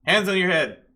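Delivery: loud, high police command; shouting police. Acted. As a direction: shout an order at a suspect. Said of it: "they're shouting just generally".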